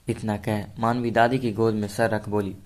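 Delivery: ordinary delivery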